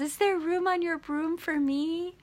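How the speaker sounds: very high little frog voice; pleading little frog. Retold or imitated. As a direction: ask in a squeaky-high, hopeful voice. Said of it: "the little frog says".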